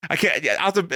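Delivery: usual speaking voice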